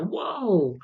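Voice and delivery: high, long-held whoa; awed kid. Retold or imitated. Two little kids seeing him fly, high-pitched awe. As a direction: imitate an amazed little kid.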